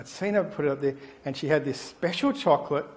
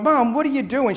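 put-on alarmed child; 8 semitones higher, 4 dB louder than usual. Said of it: "I'm going".

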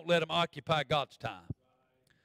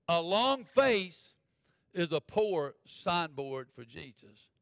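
LONG FACE drawn way out, with pauses; slow, emphatic repeat; much slower than usual. Real, not acted. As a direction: spell it out very slowly, stretching "long face". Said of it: "Let me say that again".

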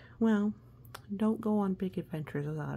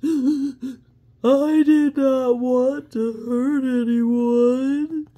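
loud, high, slow sobbing wail; dragon sobbing; 7 semitones higher, 14 dB louder, much slower than usual. Acted. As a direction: cry out the apology through sobs. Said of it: "the dragon sobs".

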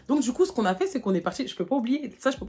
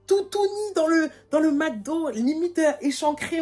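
much higher, slowly stretched out; scandalized; 9 semitones higher, slower than usual. Retold or imitated. Describing Karine's outfit to viewers, high and scandalized. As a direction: act out the shock of it. Shocked about friend's outfit.

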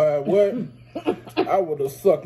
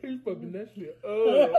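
laughing; 5 semitones higher than usual. Real, not acted. Echoes it while laughing.

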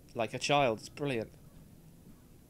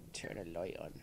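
soft whispered request; whispering in the dark garage; whispered plea; 11 semitones lower, 12 dB quieter, faster than usual. Real, not acted. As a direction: breathe it, scared to be heard.

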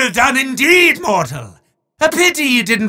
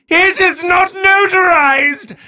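higher, shouted spooky announcement; mock horror reveal; 8 semitones higher than usual. Demon revealing the unsigned contract, hammy shout. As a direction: sell the paperwork as a horror twist.

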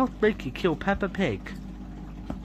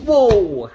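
loud, falling exclamation; falling whoa; 2 semitones higher, 8 dB louder than usual. Pretend. Player teleported back home in play, loud whoa. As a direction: cry "whoa" as if dropping.

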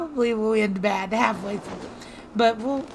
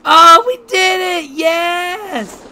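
loud, high, drawn-out cheer; triumphant shout; 8 semitones higher, 13 dB louder, much slower than usual. Real, not acted. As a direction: celebrate at full voice, stretching the words. Celebrates landing the long shot.

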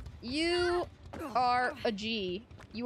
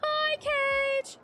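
much higher, louder sing-song hello; excited greeting; 5 dB louder than usual. Real, not acted. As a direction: greet a pal with delight. Greeting a teammate in lobby.

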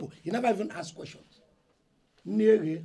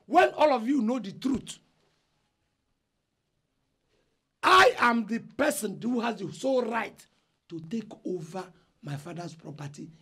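loud and higher, asserting his claim; angry outburst; 3 semitones higher, 3 dB louder than usual. Acted. Eldest son shouting his rights at the family meeting. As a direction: hammer home that he is in charge. Elder brother quarrels with family.

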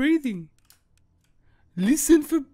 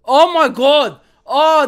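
louder, a bit higher, jolted out of the dark; loud startled outburst; 4 semitones higher, 9 dB louder, much slower than usual. Real, not acted. Gamer jolted by suspense, loud and higher. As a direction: blurt it when the tension hits.

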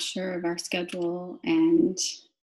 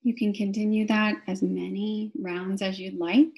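guided meditation. Real, not acted. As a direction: draw out each cue word, pausing for the breath. Guides breathing with key words.

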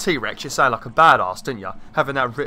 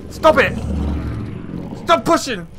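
high, loud panicked yelps; panicked shouting; 12 semitones higher, 5 dB louder than usual. Real, not acted. Player nearly pushed to death, panicked shouting. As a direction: plead urgently as you are shoved towards danger.

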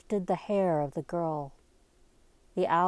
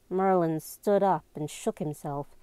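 ordinary delivery, nothing marked

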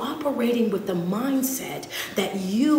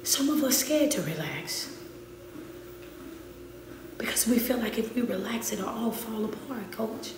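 hushed worry; 9 dB quieter than usual. Real, not acted. Coach voicing listeners' quiet fear, hushed. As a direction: let the fear slip out quietly.

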